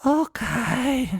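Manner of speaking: put-on high, drawn-out child's okay; high-pitched kiddie okay; kids agreeing. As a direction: impersonate a kid giving in to a deal. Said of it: "the kids would be like".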